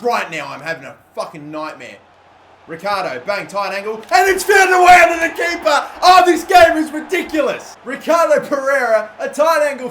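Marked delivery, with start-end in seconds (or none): 4.0-9.9 high, loud elated goal call; excited shout. Real, not acted. Gamer celebrating his go-ahead goal, excited shout. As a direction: roar the goal in.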